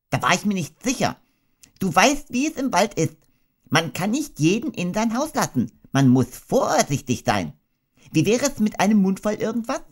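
a fussy host rattling on; brisk high nervous talk; Rabbit voice. Acted. Rabbit chatting, hosting Pooh.

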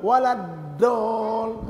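waladol, mouth open wide, dal slow and heavy. Shown as a demonstration. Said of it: "kalau kita buka mulut".

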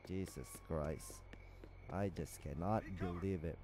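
hushed, low whisper of disbelief; disbelieving whisper. Real, not acted. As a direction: breathe it out stunned. Stunned after failing the mission.

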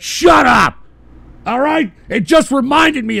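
high, loud angry yell. Real, not acted. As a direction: shout it down. Host yelling at caller.

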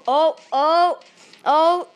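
ordinary talk

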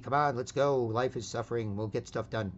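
normal talking voice

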